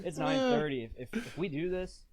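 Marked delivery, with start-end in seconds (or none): 0.0-0.8 exasperated. Acted. Replayed thought, higher, laughing.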